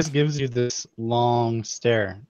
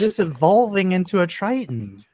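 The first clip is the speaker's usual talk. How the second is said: surprised; 9 semitones higher, 4 dB louder than usual. Acted. Servant voice realizes.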